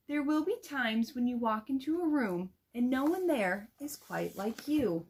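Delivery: gentle, expressive picture-book reading; read-aloud to children. Shown as a demonstration. Teacher demo-reads a picture book.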